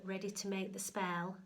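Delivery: plain reading voice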